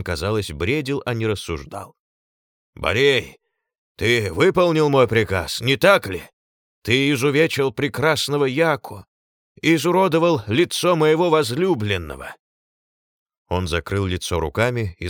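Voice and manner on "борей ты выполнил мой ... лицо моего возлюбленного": accusing. A narrator's reading. Accusing the giant.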